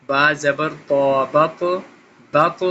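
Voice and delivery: بط with a thick, bouncing final ط; heavy qalqala. Shown as a demonstration. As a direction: bounce the ط of بط and keep the sound going. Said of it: "تو کو موٹا بھی کرنا ہے، قلقلہ بھی کرنا ہے". (بط میں موٹا ط اور قلقلہ)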